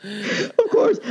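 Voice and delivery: high squeak of laughter; laughing. Real, not acted. Laughing about his own bald spot.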